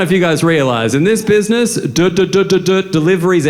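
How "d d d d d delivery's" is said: mock stutter. Shown as a demonstration. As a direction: stutter on the d, then finish. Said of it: "he's got a stutter".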